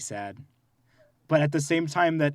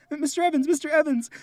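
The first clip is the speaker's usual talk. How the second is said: high, urgent calling of a teacher; panicked children calling the teacher; kids calling out; 11 semitones higher, faster than usual. Retold or imitated. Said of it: "And we go".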